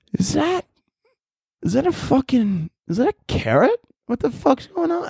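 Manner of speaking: gawk up at the sky in disbelief; squirrel alarmed. Acted. A squirrel spotting a carrot falling from the sky, high and alarmed.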